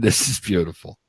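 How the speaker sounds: ordinary talk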